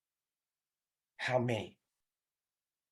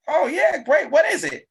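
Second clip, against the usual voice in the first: eager friend; 13 semitones higher, 6 dB louder than usual. Acted. Friend answering the call.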